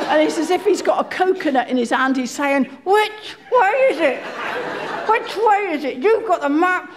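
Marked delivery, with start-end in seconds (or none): 2.8-4.2 higher, panicky "Which way is it?"; frantic high shout. Retold or imitated. Panicking husband. 5.1-6.9 frantic husband. Retold or imitated. Panicking red-faced husband quoted.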